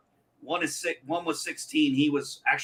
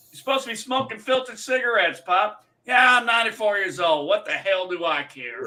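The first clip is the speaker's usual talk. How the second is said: put-on defiant old man, voice pitched up; movie old man; 7 semitones higher than usual. Acting out the first movie for friends, defiant old-timer. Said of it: "scene from the first movie when he's like".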